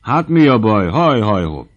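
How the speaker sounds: high, loud merry calls; boisterous cheering-up; jolly teasing. A narrator's reading. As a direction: whoop like a tipsy dancer. Jolly dancer teases gloomy hunter.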